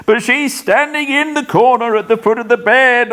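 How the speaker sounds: high, frightened voice; shivering with horror. Acted. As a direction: quake through the words in dread. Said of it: "shivering dreadful with the horrors".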